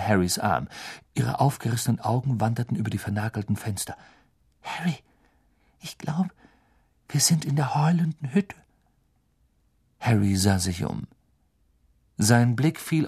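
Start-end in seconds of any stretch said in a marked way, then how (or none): 4.6-8.6 high, breathy scared whisper; frightened whisper. A narrator's reading. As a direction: whisper it, wide-eyed with fear. Hermine frightened in the Shack. (ängstliches Flüstern)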